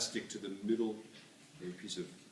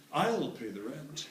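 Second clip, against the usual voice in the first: melodrama hero; 6 semitones higher, much slower than usual. Pretend. Hero rescues.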